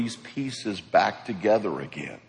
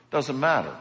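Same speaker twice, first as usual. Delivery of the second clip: ordinary delivery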